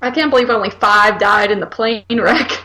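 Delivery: normal talking voice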